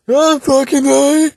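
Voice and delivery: high, loud, slow mock yell; imitating a yelling drunk woman, slowed and high; drunk ogre yell. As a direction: ham up a drunk woman bellowing. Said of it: "she sounded like an ogre".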